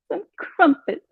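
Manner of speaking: high giggling through the words; laughing posh voice. Real, not acted. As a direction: crack up mid-impression.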